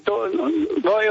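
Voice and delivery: usual speaking voice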